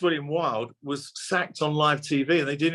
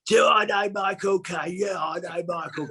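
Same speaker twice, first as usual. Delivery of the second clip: emphatic, higher-pitched Cockney impression; hammed-up London accent, higher than usual; Bob Hoskins; 5 semitones higher than usual. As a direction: do a rough Cockney mate boasting he knows him. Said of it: "it was not the best accent in the world".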